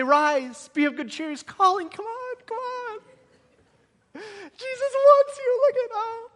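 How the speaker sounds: high, eager coaxing; excited urging. Retold or imitated. Crowd urging the blind man to come, excited.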